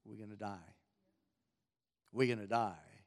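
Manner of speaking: whisper-soft dread of the crowd; hushed mock panic. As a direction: drop to a low, hushed voice, frightened. Frightened Israelites see the army.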